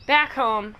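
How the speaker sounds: ordinary talk, nothing marked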